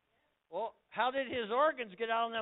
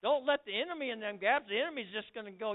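normal talking voice

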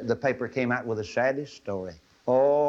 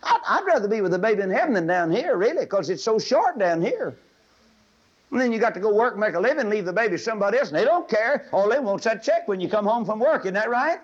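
high, loud preaching cry; full-force preaching; 10 semitones higher, 5 dB louder than usual. Acted. Preacher pleading with girls in the audience, at full force. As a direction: preach it with fire.

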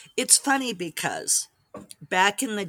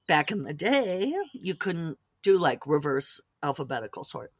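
usual speaking voice

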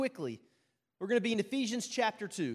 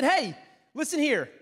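raised, slow retort; indignant retold challenge; stern confrontation; 6 semitones higher, 5 dB louder than usual. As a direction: call someone out, louder and higher. Confronting the Canadian lady, retold.

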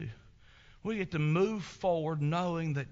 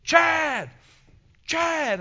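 much louder and higher, calling across the mountain; lost hunter hollering for his friend; yelling a name; 13 semitones higher, 9 dB louder than usual. Retold or imitated. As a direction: shout his name as loud as you can. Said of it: "I yelled to the top of my lungs".

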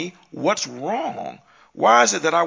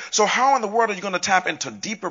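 ordinary talk

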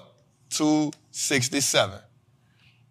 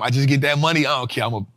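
normal talking voice

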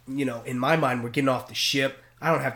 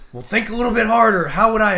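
higher, louder taunting captain; mocking captain; 9 semitones higher, 11 dB louder than usual. Captain taunting the hungover cadet, higher and louder. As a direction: needle him to figure it out.

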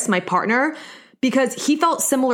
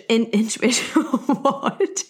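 real amused fluster at own mistake; laughing at a slip; much slower than usual. Real, not acted. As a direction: trip over the word and laugh in disbelief. Laughs at own stumbled word.

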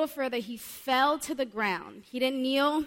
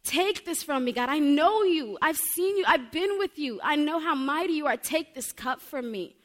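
higher and louder anguished pleading; raised pleading cry in the garden; 5 semitones higher, 5 dB louder than usual. Acted. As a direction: cry out to God, desperate. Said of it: "And he cried out".

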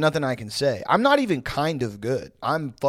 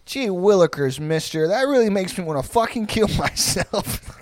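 put-on wholesome 1950s kid; 6 semitones higher, 5 dB louder than usual. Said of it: "That's how Mormons play".

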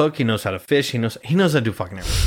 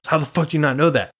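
fast, raised mock retort at dad; mild mock yell back; 6 semitones higher, faster than usual. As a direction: snap it back quicker, lightly. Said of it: "the one time I said was like".

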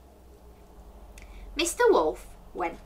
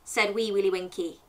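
ordinary delivery

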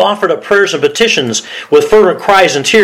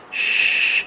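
soft, mock shushing; hushing; 9 dB quieter than usual. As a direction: shush her like a disapproving churchgoer. Acts churchgoers hushing Elizabeth.

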